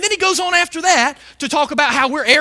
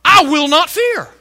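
firm, raised-voice declaration; bold declaration; 3 semitones higher, 6 dB louder than usual. Shown as a demonstration. Preacher showing what to say when tempted to fear, boldly.

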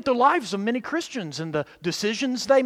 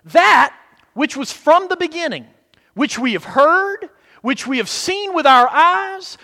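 scripture reading; 3 semitones higher, 4 dB louder than usual. Acted. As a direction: read the verse with weight. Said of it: "notice what he says in verse one".

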